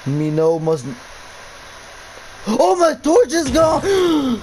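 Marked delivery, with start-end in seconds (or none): 2.5-4.4 high, drawn-out scared yell; panicked cry. Real, not acted. Player losing his torch in a horror game, panicked. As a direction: yelp in alarm, voice shooting up.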